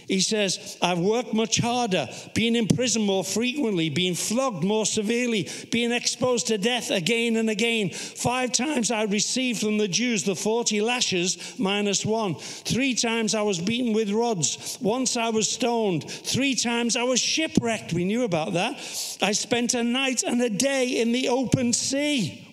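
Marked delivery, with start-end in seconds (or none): 0.8-17.9 raised, insistent list-reading voice; Scripture reading. Acted. Preacher reading out Paul's boast of hardships, insistent recital. 19.2-22.5 raised, insistent list-reading voice; Scripture reading. Acted. Preacher reading out Paul's boast of hardships, insistent recital.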